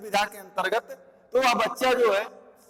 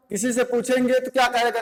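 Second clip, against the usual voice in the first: usual speaking voice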